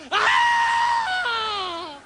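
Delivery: very high shrieking cry; woman shrieking in fright; mock shriek. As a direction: let out a high scream. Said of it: "She stands and goes".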